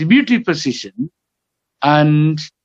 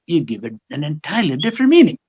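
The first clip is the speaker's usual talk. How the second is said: usual speaking voice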